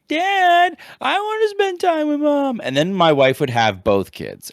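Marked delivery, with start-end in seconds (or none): 0.1-2.6 put-on high childlike whine, much higher pitch; squeaky kid begging to see mom; son's voice. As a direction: play the little boy pleading for mom. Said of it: "my son would be like".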